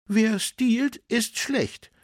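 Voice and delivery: high, loud, outraged woman; indignant cry. A narrator's reading. Woman at newsstand condemns the thief, indignant. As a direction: call it out in high-pitched indignation. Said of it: "rief eine Frau empört".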